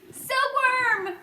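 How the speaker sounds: high, loud urgent call; urgent yell. Acted. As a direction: shout her name to get her moving. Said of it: "yelled James".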